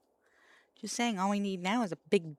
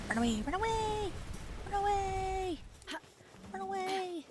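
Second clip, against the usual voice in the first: very high falsetto cry of retreat; mock-panicked squeal; 13 semitones higher than usual. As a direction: squeal it comically high, as if fleeing. Fleeing in the game.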